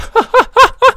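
squealing high laugh; high burst of laughter. Real, not acted. Player laughing at his character's giant head, high squeal. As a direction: crack up at the sight.

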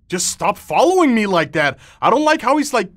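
jumpy and creeped out; scared horror game streamer. Real, not acted. Creature follows him closely.

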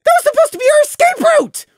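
high, loud angry yell; furious shout. Acted. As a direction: hurl it back in fury. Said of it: "Prey shot back".

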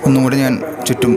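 ordinary talk, nothing marked

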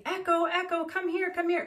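high, coaxing imitation of calling a dog. Owner calls dog in a stranger's backyard, coaxing.

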